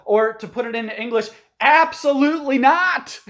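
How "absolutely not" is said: "Absolutely not." rings out loud and high; emphatic refusal. Shown as a demonstration. Preacher voicing Thomas's Greek refusal, emphatic. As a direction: slam the door with a firm no. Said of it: "most powerful way you can say no".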